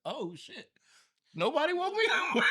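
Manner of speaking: high, loud panicked wake-up; startled yelp. Retold or imitated. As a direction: jolt awake and yelp it. Acted panicked wake-up quote.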